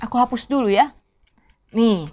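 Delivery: ordinary talk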